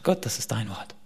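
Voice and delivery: hushed, breathy words to God; whispered prayer. Retold or imitated. Believer praying over the Bible, reverent whisper.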